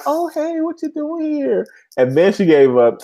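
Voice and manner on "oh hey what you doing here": very high, slow singsong greeting; surprised visitor. Retold or imitated. Podcaster acting a woman walking in, high mock greeting. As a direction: imitate her walking in, pitched way up. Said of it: "she walks in like".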